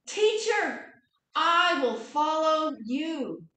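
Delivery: loud, high, then slow promise; healed beggar promising to follow Jesus; eager vow. Acted. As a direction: pledge yourself gladly. Bartimaeus vows to follow.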